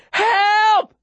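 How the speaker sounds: very high drawn-out shout; desperate cry. A narrator's reading. Boy alone in the empty square, crying out. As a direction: cry out for help to anyone. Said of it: "I cry".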